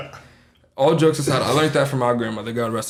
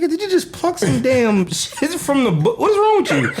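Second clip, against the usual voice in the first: high-pitched incredulous outburst; mock disbelief; 9 semitones higher than usual. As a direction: retell your baffled outrage at a friend, voice shooting up. Acts out shock at homie.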